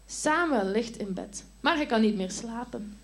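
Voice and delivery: usual speaking voice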